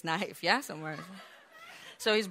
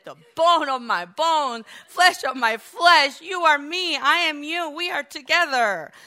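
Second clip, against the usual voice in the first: lovestruck proclamation; 8 semitones higher, 10 dB louder than usual. Retold or imitated. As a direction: proclaim it like a man overjoyed by his bride. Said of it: "He was in love".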